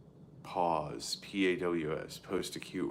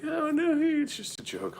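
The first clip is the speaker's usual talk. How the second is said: very high, loud mock squeal; mocking her squeal; 8 dB louder than usual. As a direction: shriek it like a ditzy girl. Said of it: "she's like".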